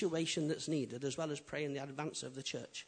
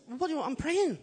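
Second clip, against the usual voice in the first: high, loud mock indignation; hammed-up, high-pitched objection; indignant protest; 10 semitones higher, 8 dB louder than usual. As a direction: protest as if interrupted mid-prayer. Acts out protesting to God.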